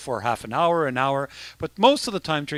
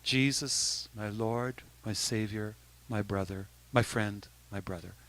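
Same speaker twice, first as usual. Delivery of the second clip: softer, lower, rhythmic mantra; quiet inner prayer; 4 semitones lower, 8 dB quieter than usual. Shown as a demonstration. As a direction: let it come from the heart, almost to yourself. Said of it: "in my heart, it just starts going".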